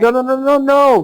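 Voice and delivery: sharp high protest, insisting; high emphatic protest. Real, not acted.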